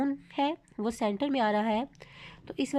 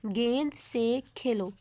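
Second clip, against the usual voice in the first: slow, deliberate, word by word; slow clear reading; much slower than usual. Shown as a demonstration. Teacher reading an example to students, slow and clear. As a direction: read it out carefully for learners. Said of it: "जो हम आपको पढ़ के सुनाएंगे".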